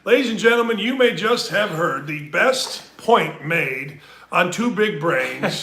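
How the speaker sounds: loud, slow showman proclamation; mock announcer. Host praising his co-host's point to listeners, slow booming announcer. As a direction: announce it like a ring MC.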